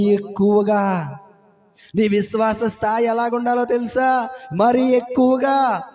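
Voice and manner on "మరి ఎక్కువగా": ordinary delivery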